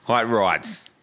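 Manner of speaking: mimicking a slipped Boston accent; playful echo of Boston pronunciation; imitated Boston accent. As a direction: tease with a Boston-accented "Hot rods". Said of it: "with a Boston accent".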